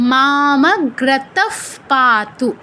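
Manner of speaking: মামগ্রতঃ পাতু very slowly, visarga as a breathy f. Shown as a demonstration. Teacher modelling for learners, slow মামগ্রতঃ ending in f sound. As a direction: draw out মামগ্রতঃ and close it on "f". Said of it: "ফয় হসন্তর মতো".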